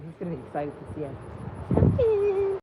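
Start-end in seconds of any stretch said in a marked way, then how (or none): 1.7-2.6 high sing-song call to a pony; calling the horse. Real, not acted. Owner calling her horse over from the paddock, high sing-song. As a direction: coax the pony out, pitched way up.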